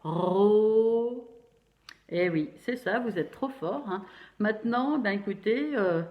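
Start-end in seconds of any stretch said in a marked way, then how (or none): 0.0-1.3 Ro called out louder; emphatic syllable. Shown as a demonstration. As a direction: sound out Ro clearly and loudly. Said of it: "on écrit comment?".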